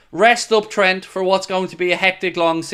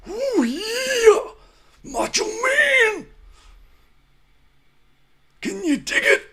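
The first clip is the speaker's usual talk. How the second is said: much higher, slow raspy wrestler growl; Macho Man impression; slower than usual. Fan imitating the wrestler's catchphrases, much higher.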